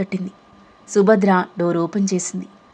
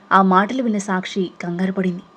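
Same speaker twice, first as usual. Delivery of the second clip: ordinary delivery, nothing marked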